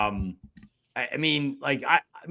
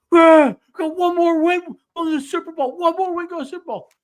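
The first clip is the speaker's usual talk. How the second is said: high, loud, elated yell of a fan; retold screaming celebration; 9 dB louder than usual. Fan celebrating the touchdown in front of his kids, screaming. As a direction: scream it like you just burst in from the kitchen. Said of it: "and I'm screaming".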